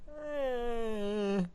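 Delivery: high, soft, drawn-out noncommittal eh; unimpressed eh. Retold or imitated. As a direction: shrug it off in a small high voice. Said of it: "and I'm like".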